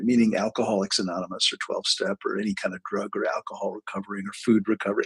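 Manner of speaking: usual speaking voice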